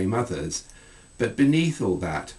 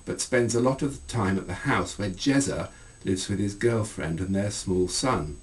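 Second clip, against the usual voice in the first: ordinary delivery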